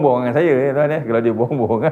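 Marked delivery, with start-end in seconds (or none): none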